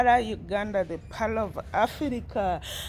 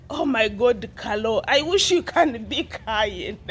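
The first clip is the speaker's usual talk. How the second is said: ordinary delivery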